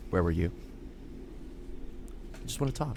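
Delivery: low, hushed, ominous parent; quiet mom confrontation. Acted. Mom waiting up for her kid, quiet and ominous. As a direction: ask it softly, lying in wait.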